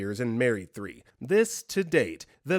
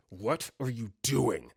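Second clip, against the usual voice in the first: slow, menacing sleep-talker; angry; 3 semitones lower, 3 dB quieter than usual. Retold or imitated. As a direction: space the words out in cold anger. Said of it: "He's so angry".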